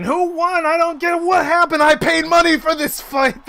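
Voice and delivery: very high, loud squawky complaints; angry spectators. Acted. Confused crowd who paid for the fight, high loud grumbling. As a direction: pitch it way up as indignant punters. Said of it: "There's a bunch of people".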